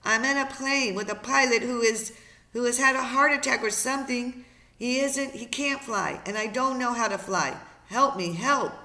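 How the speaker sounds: loud, high, panicked explanation; desperate plea. Acted. Boy with stricken pilot begs radio for help, desperate plea.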